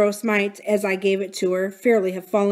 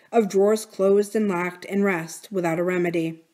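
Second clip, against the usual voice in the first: usual speaking voice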